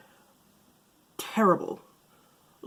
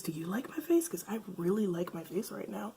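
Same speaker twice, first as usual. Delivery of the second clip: whispering; 8 dB quieter than usual. Real, not acted. Admiring herself in camera.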